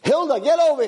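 high summoning call; master's imperious holler. Acted. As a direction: call her across the kitchen, pitched high. Master calls Hilda over.

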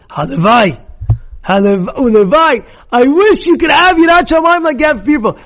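loud, high, impassioned wish; fervent exclamation. Acted. Dying rabbi's fervent wish to students.

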